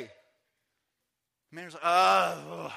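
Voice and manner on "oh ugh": loud, weary mock groan; acted groan of exasperation; exasperated groan. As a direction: groan it out, fed up. Fed-up manager groans.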